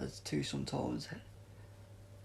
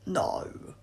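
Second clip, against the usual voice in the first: ordinary delivery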